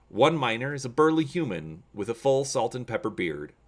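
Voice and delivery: ordinary delivery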